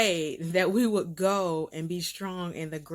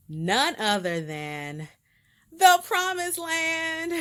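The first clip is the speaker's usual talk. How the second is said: excited reveal; 10 semitones higher, 5 dB louder, slower than usual. Real, not acted. Big reveal of her trip.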